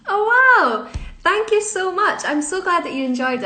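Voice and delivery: overjoyed exclamation of thanks; delighted. Real, not acted. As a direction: gush with sudden delight. Reading a lovely fan message.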